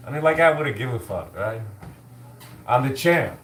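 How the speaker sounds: ordinary delivery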